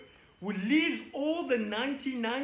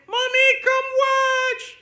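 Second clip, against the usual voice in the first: high, loud sing-out call to mom; 13 semitones higher, 11 dB louder, slower than usual. Pretend. Child calls mommy over to watch, loud high holler. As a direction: holler for mom with long held words.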